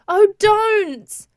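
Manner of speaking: high, loud anguished cry; cried out. A narrator's reading. Sister cries out at the patient's grim joke, high and loud. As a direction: cry out in protest. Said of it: "her sister cried out".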